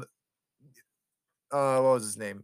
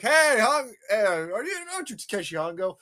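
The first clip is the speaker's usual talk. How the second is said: agents' greeting; 6 semitones higher than usual. Retold or imitated. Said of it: "they're like".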